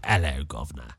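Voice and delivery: low, quiet Cockney greeting; a soft Cockney "hello, governor"; Cockney accent. Shown as a demonstration. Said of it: "talking Cockney".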